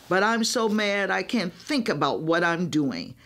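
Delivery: ordinary delivery, nothing marked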